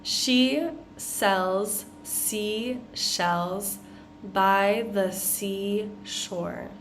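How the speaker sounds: "She sells seashells by the seashore" stretched out slowly. Shown as a demonstration. Teacher says it slowly for students to repeat. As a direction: draw each word out for learners to copy. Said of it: "Repeat after me".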